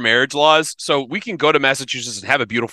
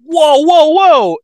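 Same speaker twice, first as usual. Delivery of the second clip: high, loud whoas; urgent interjection; 9 dB louder, much slower than usual. Real, not acted. Halting friends over a new marriage-law find, loud high whoas. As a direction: cut in loudly, pitched way up.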